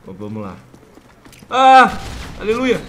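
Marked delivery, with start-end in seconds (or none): 1.5-2.8 triumphant shout. Real, not acted. Throws the grenade.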